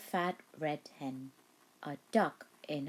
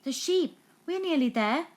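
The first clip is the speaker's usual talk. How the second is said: higher, eager thief voice; Len the thief; 10 semitones higher, 5 dB louder than usual. A narrator's reading. Len thinks they're nearly at the cow, higher. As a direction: sneak on like a crook almost there. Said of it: "said Len".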